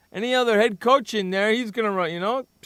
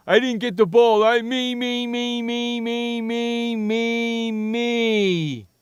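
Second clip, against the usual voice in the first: one long, higher mocking drone of self-pity; mocking whiny player; 3 semitones higher, much slower than usual. Mocking a player who only cares about himself, whiny drone. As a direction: stretch it out like a self-obsessed whiner. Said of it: "all he's doing".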